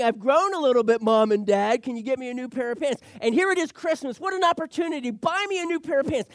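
high, loud, excited begging; eager pleading. Acted. Child asking Mom and Dad for new pants, eager pleading. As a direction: plead loudly like a kid who spots an opportunity.

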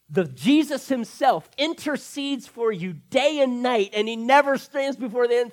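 full-throated sermon climax; preaching at full force. Real, not acted. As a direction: preach it out to the church.

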